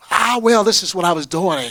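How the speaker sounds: loud, high-pitched imitation of arguing back; mock protest. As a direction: act out a player's noisy excuse.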